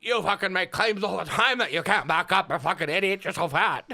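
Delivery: high, loud slurred ranting impression; Suede, loud insults. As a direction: bellow garbled accusations in mock outrage. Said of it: "he says something like".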